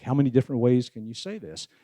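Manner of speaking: ordinary delivery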